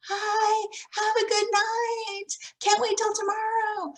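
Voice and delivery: high, bright cheerful teacher hello; cheerful greeting. Shown as a demonstration. Teacher greeting a parent at pickup, high and cheerful. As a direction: greet them with a big happy voice. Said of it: "say something positive".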